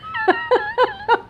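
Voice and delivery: long, high-pitched laugh. Real, not acted. Vlogger catching herself on politics, high laugh. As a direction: let out a high squeal of laughter. Laughs aloud mid-remark.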